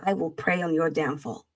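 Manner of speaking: low, deliberate mock menace; mock threat. Host warns superchat donor in a low, mock-solemn threat. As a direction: solemnly warn him with long pauses.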